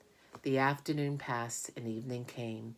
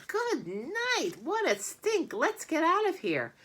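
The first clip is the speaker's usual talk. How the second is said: put-on high, loud disgusted boy; high boyish yelling in disgust; 4 dB louder than usual. A narrator's reading. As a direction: get away from the stink. Avery flees stink.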